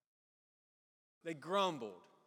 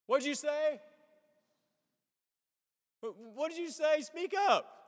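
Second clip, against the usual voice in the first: much higher and louder, an exasperated parent's demand; parent snapping back; 10 semitones higher, 8 dB louder than usual. Retold or imitated. Parent up front to grumbling kids in back, loud annoyed demand. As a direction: bark it at the back seat like a fed-up parent. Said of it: "what does the parent in the front usually do".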